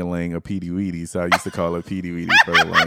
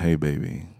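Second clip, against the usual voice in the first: sexy voice; 4 semitones lower, 4 dB quieter than usual. Shown as a demonstration. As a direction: lower seductive greeting. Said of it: "your eyes was, like, real seductive".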